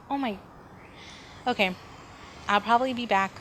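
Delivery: ordinary talk, nothing marked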